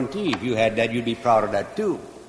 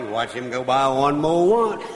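normal talking voice

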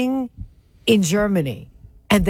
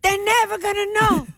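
much higher, hammed-up voice of a sure old father; mock dad; 9 semitones higher than usual. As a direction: insist the neighbors will never notice. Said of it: "And your father's like".

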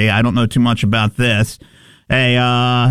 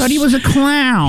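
insistent; 11 semitones higher than usual. Real, not acted. Heated argument over Patch Adams.

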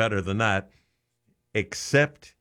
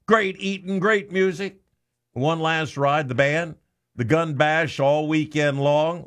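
upbeat, higher ad read; radio promo read; 5 semitones higher than usual. Acted. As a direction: sell the event on air.

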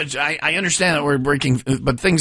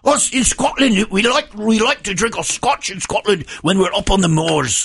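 higher, hammed-up Scottish brogue; Scottish accent; 6 semitones higher than usual. Shown as a demonstration. Co-host proving it to the newsreader, Scottish accent. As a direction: lay on a thick Scottish brogue. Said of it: "No, that's Scottish. That is straight up brogue".

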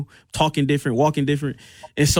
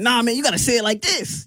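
higher-pitched retold urging; coaching grown-ups, imitated; 9 semitones higher than usual. Dad and his friend teaching a four-year-old to cuss, imitated urging. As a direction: imitate adults coaching a little kid, pitched up. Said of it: "They said".

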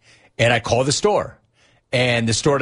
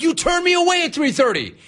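much higher, incredulous protest; indignant. Retold or imitated. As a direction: throw it back at him, outraged and high. Retold indignant reply to clerk.

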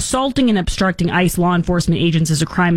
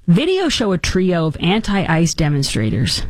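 ordinary talk

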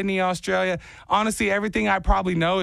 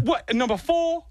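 higher bad Jersey accent; failed Jersey accent; 9 semitones higher than usual. Third try at the elevator guy, bad Jersey accent. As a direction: give up halfway on a Jersey accent. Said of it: "No, that's bad again".

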